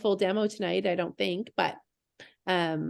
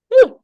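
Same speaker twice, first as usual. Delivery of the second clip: laugh and whew; 17 dB louder than usual. Real, not acted. Laughing, blowing out breath.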